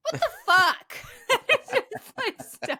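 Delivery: high, loud laughing outburst; laughing disbelief. Real, not acted. Host reacts to cruel nickname, laughing loudly. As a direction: laugh it out in shock.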